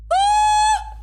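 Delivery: high, loud startled scream. Real, not acted. Streamer stuck on the puzzle, sudden high scream.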